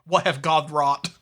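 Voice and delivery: slow, ominous mock gravity; a slow doom-laden mock line, into the camera. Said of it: "stares ominously in the camera like".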